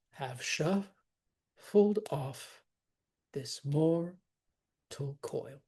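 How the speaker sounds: "Have shuffled off / this mortal / coil" slowly, with gaps; separated into feet. Shown as a demonstration. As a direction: draw out each foot, pausing before "coil". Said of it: "separated into feet".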